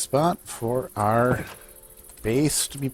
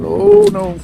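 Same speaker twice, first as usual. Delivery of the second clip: sudden high startled cry; alarmed yelp; 12 dB louder than usual. Real, not acted. Dragon suddenly appears.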